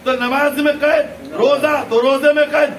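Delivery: loud, high-pitched fervent sermon; full-force preaching. Real, not acted. Preacher urging listeners to follow Ali, impassioned shouted preaching. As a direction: pound out the list, building to shouted peaks.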